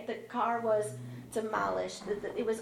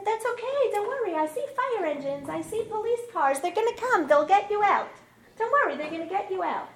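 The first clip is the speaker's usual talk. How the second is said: high, loud, rapid-fire reassurances; pitched high and loud, insisting help is coming; urgent reassurance; 11 semitones higher, 8 dB louder than usual. Retold or imitated. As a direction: call out encouragement to someone trapped. Calming her trapped husband at the crash.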